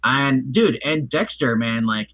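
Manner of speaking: normal talking voice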